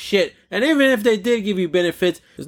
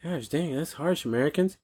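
much softer imagined-listener aside; hushed reaction; 3 semitones lower, 9 dB quieter than usual. Retold or imitated. Imagined listeners abroad react to Americans' bills, hushed aside. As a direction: drop right down, as if quietly taken aback.

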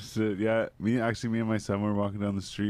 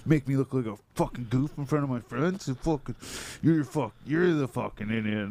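higher mock rant at nobody; light mock yell, higher; imitated angry yelling; 4 semitones higher than usual. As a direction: play the furious passerby, pitched up. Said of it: "He's like".

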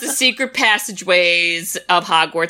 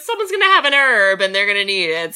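excited laughing Shuri; 6 semitones higher than usual. Retold or imitated. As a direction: brag giddily about the surprise. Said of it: "And she's like".

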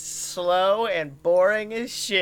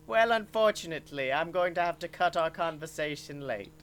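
menacing Herzog; 4 dB quieter, faster than usual. Acted. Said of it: "and he goes".

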